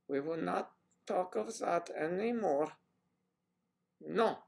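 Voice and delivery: lightly put-on Gestapo movie German; mock German accent. Mock German accent, waving off the Gestapo joke. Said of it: "Gestapo, no".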